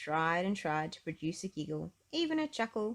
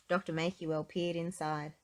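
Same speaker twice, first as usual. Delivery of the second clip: ordinary delivery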